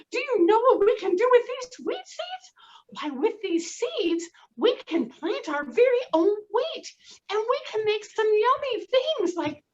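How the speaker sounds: high, loud, thrilled hen voice; high excited hen calling her chicks; excited mother hen. Acted. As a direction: gush like a delighted mother hen.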